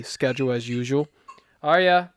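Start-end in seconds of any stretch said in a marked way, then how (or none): 1.6-2.1 calling out. Real, not acted. Parent calling to toddler.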